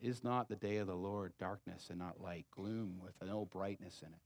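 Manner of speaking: ordinary talk, nothing marked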